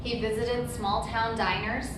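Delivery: usual speaking voice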